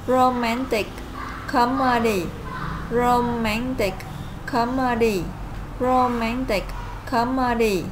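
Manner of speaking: "romantic" stressed on MAN, "comedy" on CO, each falling; careful teacher read of "romantic comedy"; model pronunciation. Shown as a demonstration. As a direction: drill "romantic comedy" clearly for the class. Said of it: "Okay, now listen".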